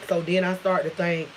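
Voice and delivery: ordinary delivery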